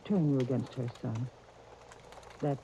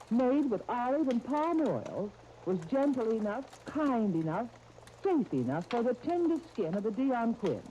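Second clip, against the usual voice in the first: earnest, persuasive ad pitch; soap commercial read; 7 semitones higher than usual. Acted. Announcer pitching soap to mothers, earnest and persuasive. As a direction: sell it gently to mothers.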